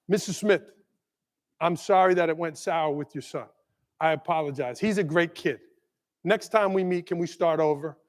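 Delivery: a touch of sincere apology; gentle apology letter. Retold or imitated. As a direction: read the apology kindly. Letter to parent read out.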